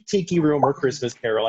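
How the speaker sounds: ordinary talk, nothing marked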